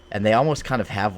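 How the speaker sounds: normal talking voice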